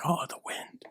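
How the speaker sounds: breathy mystic whisper. Acted. Fortune teller murmuring a mystic omen. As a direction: hush it like a seer.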